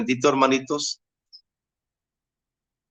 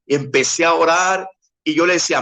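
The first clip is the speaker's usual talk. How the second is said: usual speaking voice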